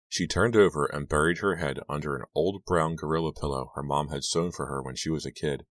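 usual speaking voice

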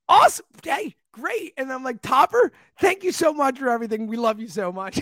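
high, excited fan gushing; gushing like a kid. Retold or imitated. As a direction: gush like a starstruck kid. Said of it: "I was like a kid, like, gushing".

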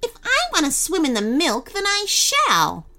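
high, haughty cookie insistence; defiant mock shout. A narrator's reading. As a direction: insist snootily, stretching the last words. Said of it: "shouted the cookie".

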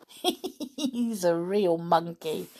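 laughing fondly through it; slow, laughing amused remark. Acted. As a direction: chuckle the words out. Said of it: "said George, laughing".

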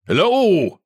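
high, loud hello; shouted greeting. A narrator's reading. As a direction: call out across the camp. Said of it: "he shouted at their approach".

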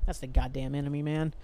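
ordinary delivery

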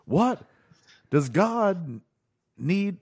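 high, halting, dramatic pauses between words; William Shatner impression. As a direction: pause after each word, then land starship. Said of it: "the most Shatner Shatnerism".

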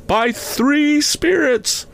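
very high, slow spectral pronouncement; foreboding ghost. A narrator's reading. Ghost announcing the haunting to Scrooge, foreboding high voice. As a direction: intone it high and drawn, like a doom.

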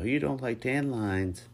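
high, loud, drawn-out whine; complaining. A narrator's reading. Lucas pressing his point, complaining. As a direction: stretch out the nagging complaint. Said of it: "I ignored his complaints".

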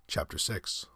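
ordinary delivery

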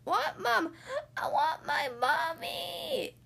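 put-on high, drawn-out toddler whine; bellowing baby. A narrator's reading. Baby carried away from Mom, wailing. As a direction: wail for Mommy. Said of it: "bellowed the baby".

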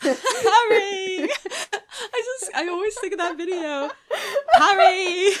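high, loud "Harry" through laughter; Derek impression. A reader laughing through an impression of a video. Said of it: "my Derek impression".

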